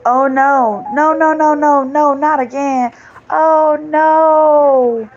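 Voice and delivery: hammed-up despair; dismayed oh no. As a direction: wail that it's happening again. Dismay at return letter.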